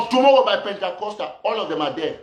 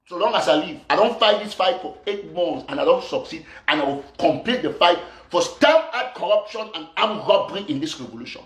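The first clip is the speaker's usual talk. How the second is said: ordinary talk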